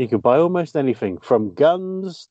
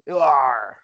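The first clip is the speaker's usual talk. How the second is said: pirate voice. Pretend. Talk Like a Pirate Day.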